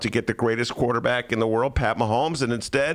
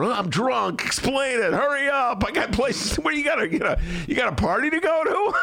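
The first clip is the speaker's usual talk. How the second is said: drunk guy impression; 12 semitones higher than usual. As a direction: bark it like a rowdy drunk. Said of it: "that's my drunk guy invitation".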